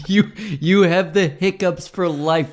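high, slow, laughing reply; laughing mock greeting. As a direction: laugh through the quote. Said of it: "And I was like".

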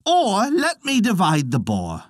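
high, mocking taunt. A narrator's reading. Ket goading the silent Ulster warriors, high taunting. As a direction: goad them on, pitched high and sneering. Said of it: "cried Ket tauntingly".

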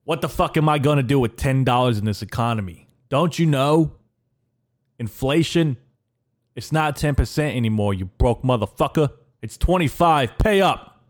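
a light mock New York Italian mobster voice, rising as it goes; Tony Soprano accent. Voice in his head demanding money, light mock Italian-American mobster accent. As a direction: do the voice in his head as a wiseguy shaking him down. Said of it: "it was like the Tony Soprano's accent".

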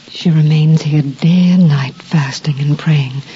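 reverent hushed whisper. Acted. Telling Joseph about Anna's devotion, whispered. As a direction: drop to an awed whisper.

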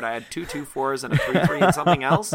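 ordinary talk, nothing marked

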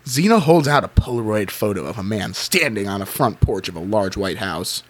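ordinary talk

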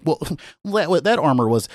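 put-on pedantic internet-nerd voice. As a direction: imitate a quibbling armor historian. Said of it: "internet armor historian nerd voice".

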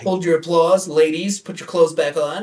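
high, loud mock-suave showman; milking the applause. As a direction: bask in imaginary applause, hammed up. Mock bragging to imaginary fans.

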